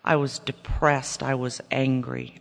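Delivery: normal talking voice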